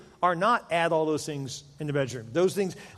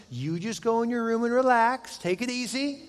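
mock-gentle, higher, doting tone; sweet husband voice; 6 semitones higher than usual. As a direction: play the overly helpful husband, higher pitch. Husband sweetly sends wife off.

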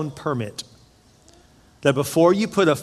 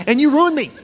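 higher, louder joking outburst; mock accusation; 10 semitones higher, 5 dB louder than usual. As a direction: blame them loudly in mock outrage. Mock accusation at his kids.

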